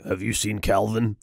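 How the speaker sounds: slow, low, softened mock menace; low character voice. Ride character questioning the guests, slow low voice. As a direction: drop low and draw it out.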